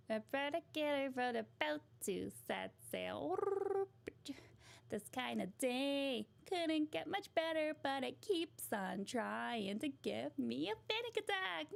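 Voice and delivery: sing-song silly sponge; SpongeBob impression. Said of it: "a SpongeBob would be a good one".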